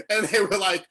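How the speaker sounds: ordinary talk, nothing marked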